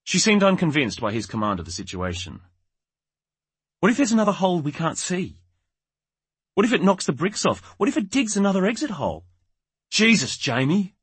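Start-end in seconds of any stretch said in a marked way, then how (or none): 3.8-9.3 high-pitched string of worried questions; anxious. A narrator's reading. Wife unconvinced by the bricks, anxiously questioning. 9.9-10.9 high, loud, drawn-out exasperation; exasperated. A narrator's reading. Husband fed up with wife's what-ifs, exasperated.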